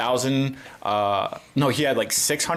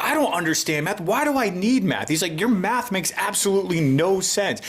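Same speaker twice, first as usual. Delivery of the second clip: mock whiny kid; 7 semitones higher than usual. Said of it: "he's just like".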